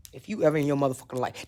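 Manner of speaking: low, quick demonic threat. Retold or imitated. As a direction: threaten like a possessed mother. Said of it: "I swear I heard a demon voice".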